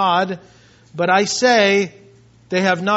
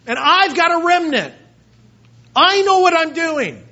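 divine response; 8 semitones higher than usual. Acted. God answering the despairing Elijah: breezy, confident reassurance. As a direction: answer him like a confident old buddy. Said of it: "the divine response says".

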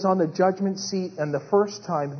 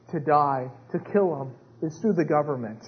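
ordinary talk, nothing marked

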